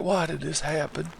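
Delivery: high, small, soft voice; mock the skeletons' puzzled question in a quiet high voice; bewildered skeletons. Skeletons reeling after being hit, high soft bewilderment. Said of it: "They're like".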